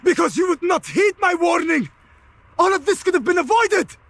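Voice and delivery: angry accusation. Acted. Malik blames Altair for brother.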